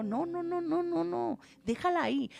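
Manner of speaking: quick gentle refusal. Acted. Acts the Lord stopping the sower.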